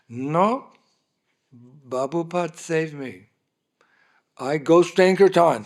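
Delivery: imitating a stubborn, broken-English devotee pushing back; angry protest. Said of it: "He got angry".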